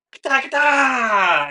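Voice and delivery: loud high shouted war cry; battle cry. Acted. GM voices character's battle cry.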